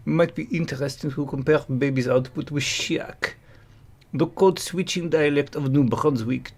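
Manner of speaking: English read in a mock French accent; thick put-on French accent. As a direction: play a Frenchman reading his thread.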